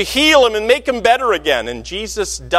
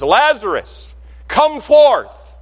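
rising, louder call to the dead man; 5 semitones higher, 3 dB louder, much slower than usual. Acted. Jesus calling Lazarus out of the tomb, loud and rising. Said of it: "with a loud voice".

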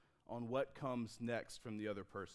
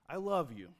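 louder, earnest I love you; loud declaration; 2 semitones higher, 5 dB louder than usual. Acted. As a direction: declare it like a movie lover.

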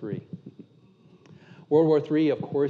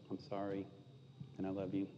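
much softer, a quiet heartfelt sorry; tender apology; 6 semitones lower, 17 dB quieter than usual. Retold or imitated. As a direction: say it gently, like making up with your wife. Apologizing to wife, tearful reconciliation.

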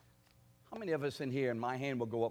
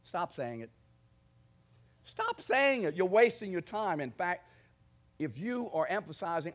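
usual speaking voice

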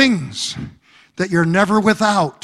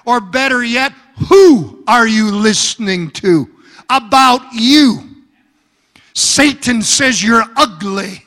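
usual speaking voice

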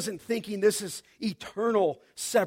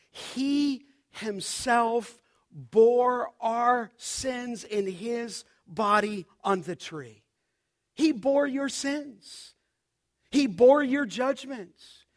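rising preaching climax; 6 semitones higher, slower than usual. Acted. Preacher to congregation at communion, building to a fervent climax. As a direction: drive home each bore line, climbing.

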